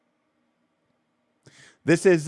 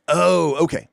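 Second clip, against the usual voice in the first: sudden realization; 7 semitones higher, 6 dB louder, slower than usual. Retold or imitated. Player suddenly realizes the ogres.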